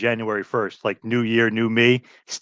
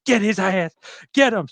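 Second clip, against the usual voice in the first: high, strained egging-on; Dusty commentating; 8 semitones higher than usual. Retold or imitated. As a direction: cheer on the beating like an excited commentator. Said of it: "He goes".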